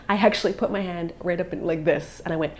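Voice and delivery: ordinary delivery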